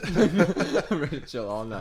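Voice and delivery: laughing through the words; said while laughing. Real, not acted.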